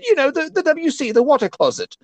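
high, put-on British accent; hammed-up British tourist accent. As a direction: explain it as a pretend Brit. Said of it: "talking with the British accent".

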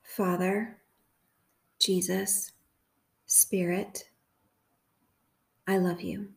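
slow, measured prayer with long pauses; guided meditation prayer. Real, not acted. Host leading listeners through a prayer for confusion, measured meditation. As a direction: lead a meditation phrase by phrase, leaving room to repeat.

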